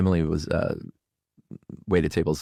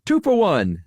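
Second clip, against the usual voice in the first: high, loud vendor call; stadium vendor hawking; 12 semitones higher, 4 dB louder, slower than usual. Shown as a demonstration. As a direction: shout a deal at the ballpark.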